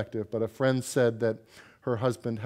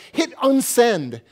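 loud, higher, slow panicked plea; panicked demand; 11 semitones higher, 9 dB louder, slower than usual. Retold or imitated. Husband begging wife to undo the sent email, loud and slow.

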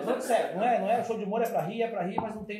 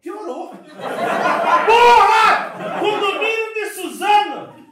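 excited shout; 8 semitones higher, 11 dB louder, much slower than usual. Acted. Shocked reaction to Suzano.

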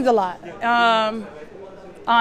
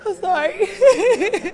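real happy crying, higher pitched; laughing through tears; 5 semitones higher, much slower than usual. Real, not acted. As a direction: apologize as a teary laugh breaks through. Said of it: "these are tears of joy".

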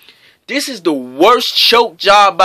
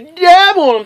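high, louder mocking taunt; 10 semitones higher, 4 dB louder than usual. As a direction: sneer it back in a high voice.

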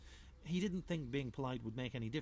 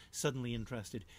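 ordinary delivery, nothing marked

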